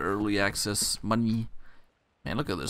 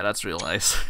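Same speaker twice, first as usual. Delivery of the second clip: ordinary talk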